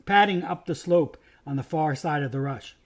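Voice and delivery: usual speaking voice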